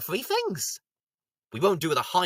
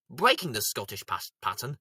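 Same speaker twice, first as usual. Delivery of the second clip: normal talking voice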